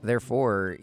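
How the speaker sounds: ordinary delivery, nothing marked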